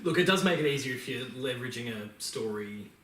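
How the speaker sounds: ordinary talk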